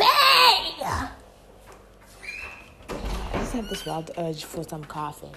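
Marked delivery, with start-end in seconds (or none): 0.0-1.1 sudden very high loud squeak; high yelp. Real, not acted. Startled mid-sentence, a high loud squeak.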